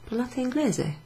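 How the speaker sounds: Parlate inglese? with the voice lifted high on inglese; question intonation. Shown as a demonstration. Teacher shows a question by intonation alone, high pitch. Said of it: "use your intonation to show him it's a question".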